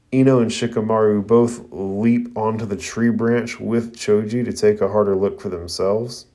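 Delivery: usual speaking voice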